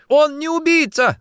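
very high, loud desperate defence; screamed. Acted. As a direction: shriek it in his defence. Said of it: "закричала Варя".

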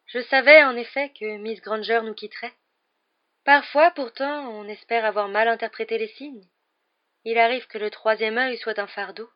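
Trelawney's mystic voice. A narrator's reading. Professor Trelawney lecturing her divination class, in a high, slow mystic voice. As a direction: intone it like a dreamy seer.

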